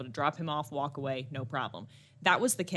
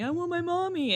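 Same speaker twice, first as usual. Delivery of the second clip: put-on higher whiny kid voice; crying toddler; 10 semitones higher than usual. Son crying for his mother, higher and whiny. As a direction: whine it like a sad toddler. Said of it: "was crying".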